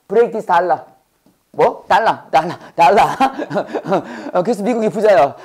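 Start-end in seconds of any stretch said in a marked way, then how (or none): 3.1-4.3 short, breathy burst of chuckling; amused laugh. Real, not acted. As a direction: chuckle at own silly joke. Laughs at own dollar wordplay. (재미있어서 터진 웃음)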